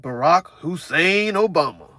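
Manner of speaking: imitating them, slow, "Hussein" hit hard; stress on Hussein. Mocking how the speakers stress "Hussein". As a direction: slowly say it all, punching "Hussein". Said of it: "they really put emphasis on that".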